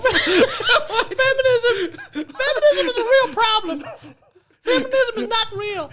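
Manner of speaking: put-on very high little-girl squeak; Jordan Peterson. Lampooning the psychologist on feminism, little-girl squeak. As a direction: preach it in a squeaky mock voice. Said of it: "sound like a little girl".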